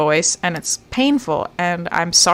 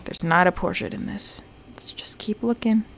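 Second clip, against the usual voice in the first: whisper; 7 dB quieter than usual. Real, not acted. Whispered aside during search.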